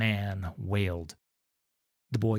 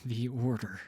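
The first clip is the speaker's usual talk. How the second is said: menacing whisper. Acted. Vannis menaces the family.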